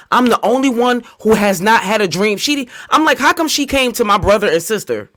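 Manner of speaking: aching to be visited by her late mother; upset and pleading. Real, not acted. Sister grieving, begging for visits.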